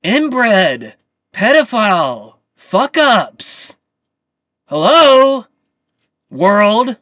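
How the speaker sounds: loud spat-out insults; shouted insults. Real, not acted. Ranting vlogger yells insults at the elites. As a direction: yell each insult out.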